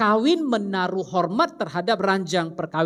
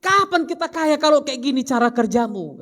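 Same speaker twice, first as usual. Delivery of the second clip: mock angry wife; 6 semitones higher, 3 dB louder than usual. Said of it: "istrinya ngamuk-ngamuk".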